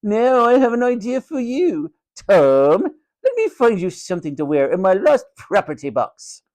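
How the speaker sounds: high-pitched matron with mock helpfulness; scheming haughty matron. A narrator's reading. Matron offering Tom lost property, high and gloating. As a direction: announce your idea with sly relish.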